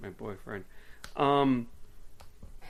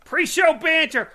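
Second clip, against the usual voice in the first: high, loud segment call; big mock announcer yell; announcing shout; 12 dB louder than usual. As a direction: shout it out like a show title. Calls out the segment title.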